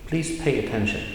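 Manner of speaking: ordinary talk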